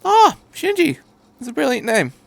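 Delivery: put-on beam at the boy's name; cheerful. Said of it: "the doctor said cheerfully".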